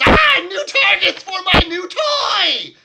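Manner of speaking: shrill cackling mad gnome inventor; mad scientist. Acted. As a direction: scream it like a manic inventor showing off. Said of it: "cackling maniacally and says".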